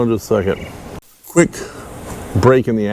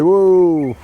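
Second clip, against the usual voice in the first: lightly voiced divine visitor, pitched higher; Jesus; 6 semitones higher than usual. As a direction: step in with a higher, playful mock-heavenly quote. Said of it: "Jesus done came in and said".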